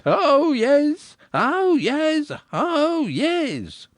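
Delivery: high, ringing town crier cry; crier's call. A narrator's reading. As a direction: call it out over the bell, pitched high. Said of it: "vigorously swung the bell and shouted".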